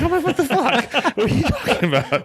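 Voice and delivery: high-pitched, laughing "what the fuck" in mock outrage; laughing disbelief. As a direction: laugh through the retold protest, way up high. Retelling disbelief at serious advice.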